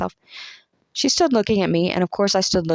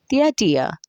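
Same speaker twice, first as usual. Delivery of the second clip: "the idea" through a checked yawn, higher at the start; stifling a yawn; 4 semitones higher than usual. A narrator's reading. Camilla laughing at Cousin Raymond's remark, stifling a yawn. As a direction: say it while holding back a yawn. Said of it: "checking a yawn".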